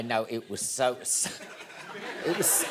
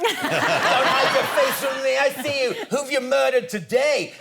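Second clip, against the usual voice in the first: high, loud shout from a balcony; hollering stranger; 9 semitones higher, 5 dB louder than usual. Retold or imitated. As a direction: imitate a heckler calling out an accusation. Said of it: "this woman on the balcony went".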